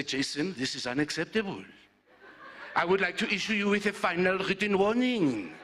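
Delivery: imitating Malik's accent as a stern boss, laughing through it; mock-formal Malik accent. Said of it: "a leader with that accent".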